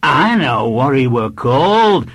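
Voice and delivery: high, loud northern-dialect schoolboy; know-it-all small boy. A narrator's reading. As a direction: pipe up loudly with the answer. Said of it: "said the small, wiry boy".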